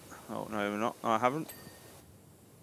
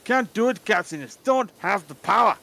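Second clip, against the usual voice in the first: put-on a light Scottish accent, higher and pleading; a mock Scottish lament; 6 semitones higher, 4 dB louder than usual. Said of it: "I just did a Scottish accent".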